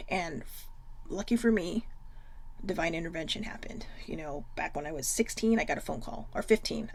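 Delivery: soft unvoiced whisper; hushed confession. Real, not acted. Confiding her dark past to viewers, hushed. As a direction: confide it in a hush.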